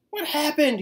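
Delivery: loud, high blare; blaring. A narrator's reading. Tacky blaring at the practicing team, loud and high. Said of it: "he blared".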